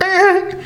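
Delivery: high screech of a stopping record; imitated record scratch. Hunter showing the music stopping at the second deer, high screech. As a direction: screech like a needle dragged off vinyl. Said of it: "the music stops, like the record".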